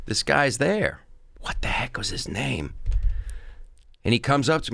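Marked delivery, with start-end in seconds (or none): 1.4-2.7 hushed, half-whispered aside; muttering to himself. Real, not acted. Comic trying to recall a rival's name, whispered to himself. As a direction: whisper it to yourself while searching your memory.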